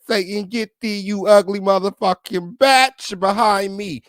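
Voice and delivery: high, loud rebuke flung at a rival; mock exorcist shout. Telling off the girl casting spells, loud exorcist shout. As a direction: cast the demon out, loud and high. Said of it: "I said".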